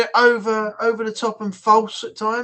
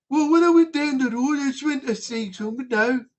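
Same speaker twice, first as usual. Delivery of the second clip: put-on Birmingham accent; 3 semitones higher than usual. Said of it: "have you not got any more excitement".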